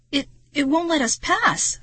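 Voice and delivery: stammering. A narrator's reading. Narrator to friend as the mantis blocks the way, stammering. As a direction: stumble on the first word, voice rising. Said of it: "I stammered".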